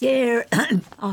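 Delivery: short of breath. Real, not acted. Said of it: "I'm a bit short of breath today".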